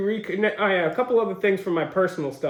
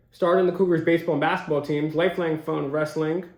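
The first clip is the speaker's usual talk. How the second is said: ordinary talk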